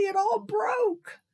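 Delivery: high, slow, sobbing wail; crying child. Acted. Nancy tearfully telling Daddy the cake broke, high slow sob. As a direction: wail it slowly through tears.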